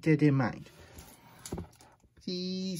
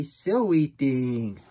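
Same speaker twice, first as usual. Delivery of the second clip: slow and louder; drawn out; 4 dB louder, much slower than usual. Acted. Book forcing grown-ups to say silly things, drawn out. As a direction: stretch the words playfully.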